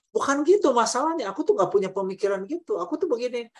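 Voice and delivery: high, insistent defensive denial; pleading it's not like that; defensive protest. Retold or imitated. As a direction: protest that you've been misunderstood. Partner's defensive reply acted out.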